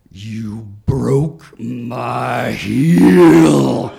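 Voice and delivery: slow, loud, menacing wail of fury; demonic drawn-out shout. Retold or imitated. Woman with a broken heel, shouting demonically at her attacker. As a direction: stretch each word out like a possessed woman. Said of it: "looked back up almost demonically and said".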